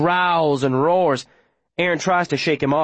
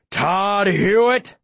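slow, drawn-out call, pitched up; menacing bellow; 4 semitones higher, much slower than usual. A narrator's reading. Pursuer calling after fleeing Todd, menacing drawn-out bellow. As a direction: stretch the name out as a threat.

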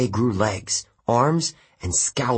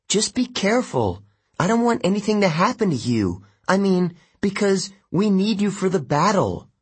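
put-on higher, anxious girl voice; flustered, caring girl; worried Annabeth voice; 10 semitones higher than usual.

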